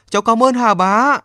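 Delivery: calling out his gratitude toward the sea; thanks rising. A narrator's reading. Boy bowing to the sea god, thanking him loudly. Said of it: "miệng nói".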